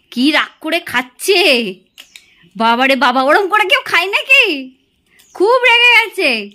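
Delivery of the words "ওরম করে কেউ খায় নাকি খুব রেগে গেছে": higher, louder teasing exclaim; playful mock-shock at her temper; amused teasing. Real, not acted. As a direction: tease her fondly for sulking.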